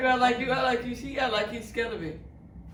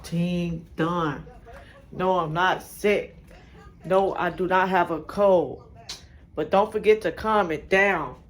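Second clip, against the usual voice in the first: loud, slow outro shoutout; hyped vlog outro; 2 semitones lower, 5 dB louder than usual. Acted. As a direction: drag out the sign-off to viewers.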